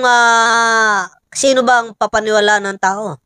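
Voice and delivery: ordinary delivery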